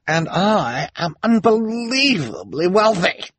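high, slow, grandiose proclamation; imperious socialite Esme. Acted. Esme boasts of her standing, haughty and grand. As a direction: announce yourself with lavish self-importance.

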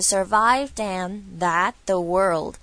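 "survived and that the world" as one connected run; linked, endings dropped. Shown as a demonstration. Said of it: "所以这个地方你会听到是".

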